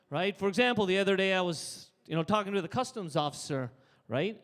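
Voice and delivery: ordinary talk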